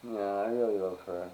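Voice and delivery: ordinary talk, nothing marked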